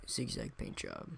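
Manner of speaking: hushed whisper, no voice. Real, not acted. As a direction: breathe the words softly. Reads paint job name whispered.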